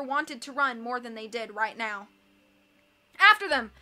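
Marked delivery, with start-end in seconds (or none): 3.2-3.7 loud urgent shout; crying out. A narrator's reading. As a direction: yell it, giving chase. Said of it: "Violet cried".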